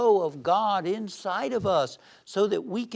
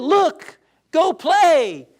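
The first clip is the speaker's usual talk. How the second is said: much higher and louder, bright coaxing call; cheerful psychiatrist; 10 semitones higher, 11 dB louder, much slower than usual. Retold or imitated. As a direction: urge the kid to go have fun. Psychiatrist in joke.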